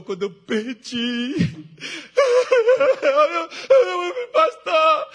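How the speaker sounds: high, breaking, tearful wail of a mourner; imitated sobbing. Grieving member sobbing to the pastor, high tearful wail. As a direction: sob out the words like a grieving church member.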